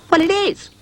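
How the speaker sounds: high, curt dog voice; a sharp little retort; snapped. A narrator's reading. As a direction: snap it back sharply. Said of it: "snapped Rhubarb".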